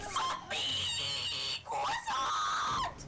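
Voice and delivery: very loud, drawn-out shout; full-voiced scream. Real, not acted. Player screaming the accent word mid-mishap, full shout.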